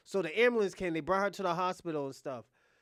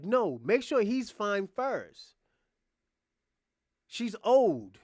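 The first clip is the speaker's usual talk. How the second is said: slow, louder curt refusal; dismissive mock order; 4 semitones higher, 7 dB louder, much slower than usual. Manager refusing help for the mother, loud dismissive mock. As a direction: brush off the old lady, loud.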